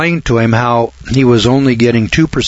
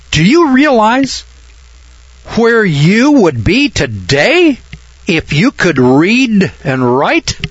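amazed exclamation; 10 semitones higher, slower than usual. Acted. Banker stunned by a rich man who can't read, exclaiming slowly. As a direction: stretch it out in disbelief.

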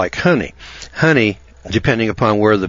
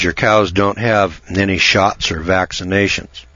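ordinary talk, nothing marked

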